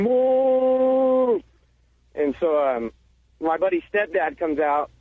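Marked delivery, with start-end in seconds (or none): 0.0-1.4 high, drawn-out mock scream for mom; long, high-pitched retold yell; imitated scream. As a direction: imitate a scared kid screaming for his mother. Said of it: "I remember my buddy just screaming".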